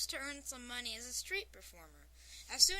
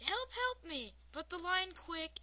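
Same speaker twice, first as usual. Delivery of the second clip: raised, half-hearted yell for help; toned-down retake of a scream, a little high; mild cry for help; 6 semitones higher than usual. A narrator's reading. Reader redoing the mime's plea, milder mock scream. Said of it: "the mime starts screaming, yelling".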